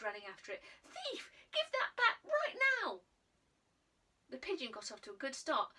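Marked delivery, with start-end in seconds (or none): 0.8-3.0 high, loud indignant yell; boy chasing his stolen cakes, shouting; shouting at a thief. Acted. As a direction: yell it after the bird as you run.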